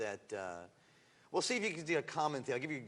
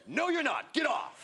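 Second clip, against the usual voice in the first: much higher, loud mock dismissal; hammed-up show judge yelling get off; mock judge shout; 13 semitones higher, 6 dB louder, slower than usual. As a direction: bark the rejection like a smug judge. Judges on Are You Hot.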